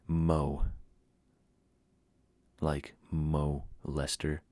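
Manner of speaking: ordinary talk, nothing marked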